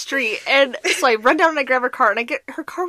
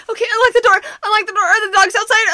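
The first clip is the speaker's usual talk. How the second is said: higher, louder, fast; panicked orders; 8 semitones higher, 5 dB louder, faster than usual. Retold or imitated. Sick wife stuck on ice ordering partner by phone, panicked. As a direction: rattle off urgent orders. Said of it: "She's like".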